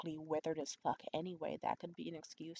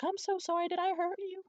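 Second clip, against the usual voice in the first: high, louder pleading retelling; fretful imitation, pitched way up; earnest apology, acted out; 12 semitones higher, 5 dB louder than usual. Apologizing to the woman she fell on, high fretful voice. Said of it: "I was truly apologizing to her".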